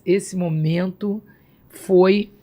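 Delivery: normal talking voice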